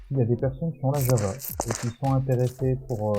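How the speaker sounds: ordinary talk, nothing marked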